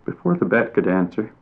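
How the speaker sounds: usual speaking voice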